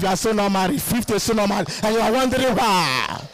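ordinary talk, nothing marked

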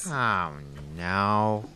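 deep dismayed groan; low groan. Acted. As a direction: drop way down in dismay. Husband groans at wife.

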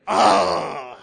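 loud, drawn-out mock wail; hammed-up cry of protest; exasperated groan. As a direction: groan loudly in comic despair. Retold exasperation at another demand.

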